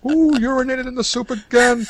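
high, crying mock yell; outraged shout. Pretend chef yelling at his kitchen staff, high and shrill. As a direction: shriek it like a furious cook.

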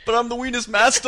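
mock boast. Comic boast, high and loud.

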